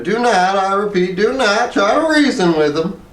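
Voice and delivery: ballerina's warning. A narrator's reading. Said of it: "said the ballerina".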